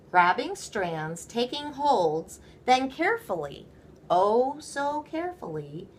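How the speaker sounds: ordinary delivery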